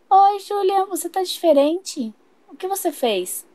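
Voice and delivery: put-on high, slow child voice, puzzled; a small girl sensing something's off; suspicious little girl. Little sister questioning her changed brother, high and slow. Emma notices the swap.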